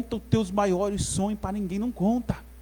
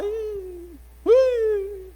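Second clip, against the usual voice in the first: long, high, doubting squeal; sceptical "ih"; 9 semitones higher than usual. Acted. Friend hearing your plan, high-pitched doubt. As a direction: draw out a high doubtful sound.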